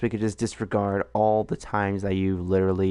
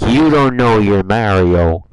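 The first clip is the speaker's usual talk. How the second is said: mock shout; 14 dB louder, slower than usual. Mock outrage shouting at friend.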